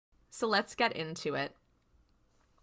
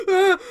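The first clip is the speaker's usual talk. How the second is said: loud, high startled cry; imitated startled gasp; 11 semitones higher, 11 dB louder than usual. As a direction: mimic jolting out of a vision, loudly. Imitating Sam waking from a vision.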